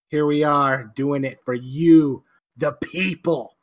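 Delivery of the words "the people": ordinary talk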